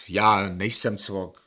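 each word slowed and pressed; slow insistence. Acted. Patient firmly telling the psychiatrist, slowly. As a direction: drag it out so he can't be misheard.

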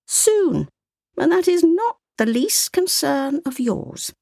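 a hint of an old woman explaining; lightly voiced grandmother. A narrator's reading. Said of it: "grandmother answered".

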